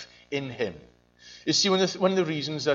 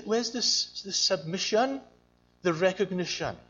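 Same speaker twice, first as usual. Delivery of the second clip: ordinary talk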